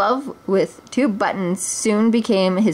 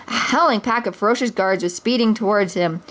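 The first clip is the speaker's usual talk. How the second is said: ordinary delivery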